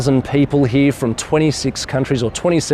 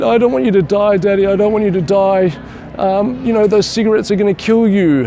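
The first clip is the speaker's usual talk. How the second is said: high mild imitation of a crying child; upset little girl; 9 semitones higher than usual. Daughter begging her father to quit, in a high, mildly tearful child voice. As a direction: plead like a tearful five-year-old, pitched up. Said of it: "absolutely hysterically crying".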